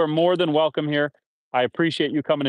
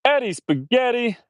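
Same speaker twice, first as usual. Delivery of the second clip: high, slow, cooing pet name; sing-song pet greeting; 9 semitones higher than usual. Real, not acted.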